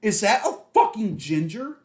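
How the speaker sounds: imitating a loud, high, tactless outburst; Ashley. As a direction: blurt it out for the whole shop to hear, mock-scandalised. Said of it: "So loud".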